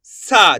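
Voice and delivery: सा with a long, forceful आ; stressed long saa. Shown as a demonstration. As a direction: push the vowel of सा hard. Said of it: "जोर लगा देंगे". (ज़ोर लगाकर खींचा हुआ सा)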